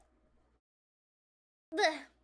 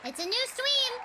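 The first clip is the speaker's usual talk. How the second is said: excited; 8 semitones higher, 3 dB louder than usual. Real, not acted. Reading wheel result, excited.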